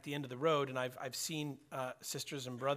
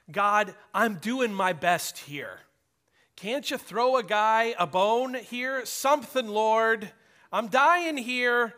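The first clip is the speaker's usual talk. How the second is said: higher and louder, slow and begging; desperate pleading; 7 semitones higher, 10 dB louder, slower than usual. Retold or imitated. Man on his knees begs the Lord, loud and slow. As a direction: cry out to God at the end of your rope. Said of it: "pleading to the Lord".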